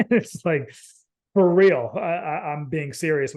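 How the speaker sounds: laughing while insisting it's serious; high, loud burst through laughter. Real, not acted. Host insisting to guest she's serious, laughing. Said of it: "I'm laughing".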